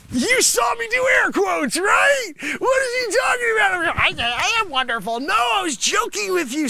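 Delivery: high, loud protest that it was a joke; shrill defensive outburst at the shark; indignant shout. Acted. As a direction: yell it, insisting you were joking.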